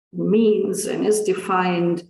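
ordinary talk, nothing marked

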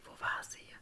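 usual speaking voice

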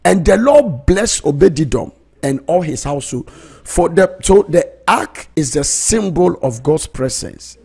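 high, loud, stretched preaching; fervent preaching. Acted. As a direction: preach it out with full fire. Preacher building to full force.

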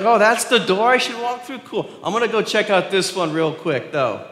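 high, loud breezy mock believer; careless shrug. Pastor mocking a careless believer, high and breezy. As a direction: play the flighty sheep who shrugs it off. Mimicking foolish sheep-like believer.

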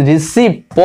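receipt broken up to show the wrong P; P sounded in receipt. Shown as a demonstration. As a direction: pick out the wrongly voiced P in receipt. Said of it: "উচ্চারণ করি". (receipt-এর P আলাদা করে উচ্চারণ)

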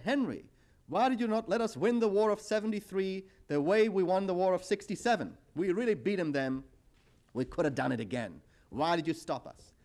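hint of an aggrieved Israeli reproaching Henry; indignant Israelis.

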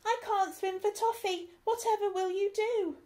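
panicked. Acted. Stew in water panicking.

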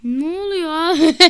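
loud laugh through the question; laughing disbelief. Real, not acted. Surprised by a sudden brawl, laughing disbelief. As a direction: crack up at the chaos.

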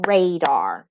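"Radar" split evenly into ra and dar; clapped syllables. Shown as a demonstration. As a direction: say "Radar" in two separate beats. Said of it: "I'm gonna clap the syllables".

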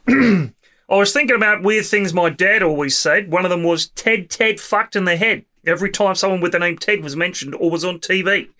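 put-on amateur Australian accent, lively lilt. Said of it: "say this in my Australian accent".

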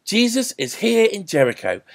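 loud, high call to the town; excited shout. A narrator's reading. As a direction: announce it loudly to everyone. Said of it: "someone shouted".

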